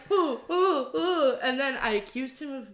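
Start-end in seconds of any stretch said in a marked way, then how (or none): 0.1-1.4 high teasing ooh, ooh, ooh; mocking ooh. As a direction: tease him with a sing-song ooh. Mocking the bouncer.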